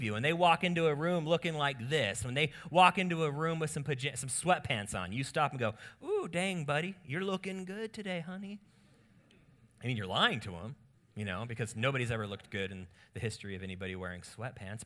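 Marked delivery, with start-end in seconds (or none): 6.0-8.6 higher-pitched admiring coo to a husband; butter him up, high and playful; wife laying on a compliment; playful flattery. Acted.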